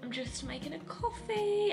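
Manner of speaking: ordinary talk, nothing marked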